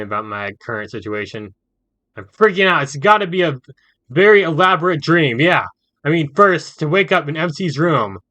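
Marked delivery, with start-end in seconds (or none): 2.2-8.2 high, loud freaking-out babble; panicked inner voice. Acted. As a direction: panic rising in his head.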